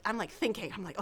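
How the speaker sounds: breathy whisper aside. Real, not acted. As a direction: drop to a whisper.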